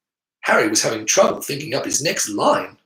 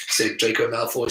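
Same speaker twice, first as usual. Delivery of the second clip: ordinary delivery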